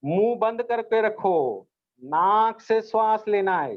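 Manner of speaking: slow, deliberate instruction; slow repeat. Shown as a demonstration. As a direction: spell it out slowly for the kids. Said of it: "मैंने क्या कहा?". (धीमे, ठहरकर दोहराना)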